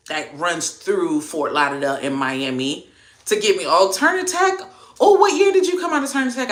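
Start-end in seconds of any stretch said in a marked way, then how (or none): 3.7-6.5 high, loud, fast eager question; excited recognition. Real, not acted. Hearing a viewer went to Turner Tech, excited. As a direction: jump in with excitement.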